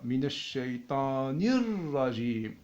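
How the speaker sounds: "Minash shaytanir rajim" recited slowly with linked words; slow recitation. Shown as a demonstration. Teacher showing the reading, "Minash shaytanir rajim" slow and linked. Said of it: "la lecture se fait de cette manière-là".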